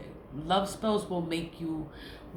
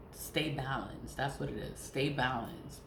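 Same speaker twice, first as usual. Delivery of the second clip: calm advice; 4 semitones lower, 7 dB quieter than usual. Real, not acted. Calming advice.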